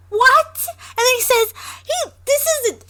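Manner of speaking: high, loud incredulous outburst; too worked up to finish the sentence. Real, not acted. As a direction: splutter in real disbelief, voice shooting up. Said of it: "I'm getting so worked up".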